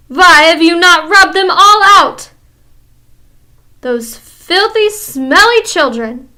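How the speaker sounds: loud, high scream, slowing on filthy smelly; screaming witch. Acted. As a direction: spit out the words in disgust. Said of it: "she screamed".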